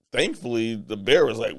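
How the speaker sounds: normal talking voice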